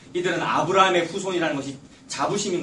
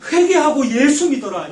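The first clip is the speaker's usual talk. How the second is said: much higher, louder, fervent plea; impassioned cry; 8 semitones higher, 9 dB louder than usual. Acted. God calling believers to repent, shouting higher and louder. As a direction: shout it as a climax. Repeated call, climax.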